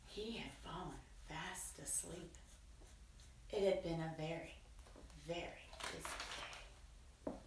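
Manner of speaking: soft, low bedtime narration; hushed. A narrator's reading. Storyteller tells children the spider is asleep, hushed. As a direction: hush it so as not to wake the sleeper.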